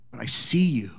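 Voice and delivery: whispered, intimate. Retold or imitated. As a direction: drop to a whisper to reach him. Teacher softening to student.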